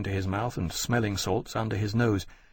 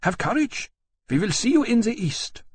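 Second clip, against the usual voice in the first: queen encouraging; 12 semitones higher than usual. A narrator's reading.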